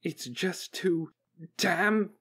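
high-pitched, harried report; alarmed technician. A narrator's reading. Technician on the jammed network, alarmed and overwhelmed.

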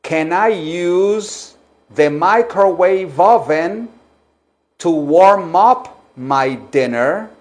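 very slow, phrase-by-phrase question for repeating; slow, projected teacher's demonstration; slow model sentence. Shown as a demonstration. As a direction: stretch it out for learners to repeat.

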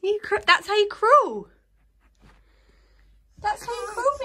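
high, bright cheering praise; sing-song praise for crawling. Real, not acted.

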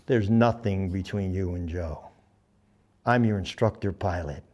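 low, measured firmness; soft-spoken, low-pitched authority; quiet firm correction. Retold or imitated. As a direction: put him in his place quietly. Bud sternly corrects Charlie.

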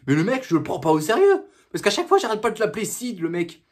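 ordinary delivery

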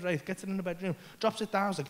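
normal talking voice

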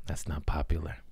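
unvoiced whisper to himself; whispered aside. Real, not acted. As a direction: whisper it like a confession.

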